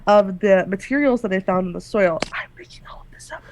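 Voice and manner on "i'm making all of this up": breathy secret whisper, fast; whispered aside. Real, not acted. GM confessing to the players in a whisper. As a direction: let it slip out under the breath.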